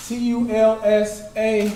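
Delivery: spelled out slowly, letter by letter; slow spelling. Shown as a demonstration. Teacher spelling Tulsa for the class to write, slowly. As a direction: space out each letter.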